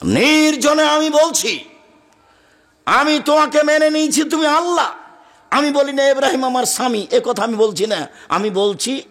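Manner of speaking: impassioned preaching. Acted. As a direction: ring it out to the congregation. Preaches at full force.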